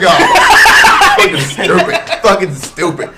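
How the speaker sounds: laughing through a sneering mock line; laughing mock quote. Mocking the movie's dumb hero line, laughing. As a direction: scoff it out between laughs.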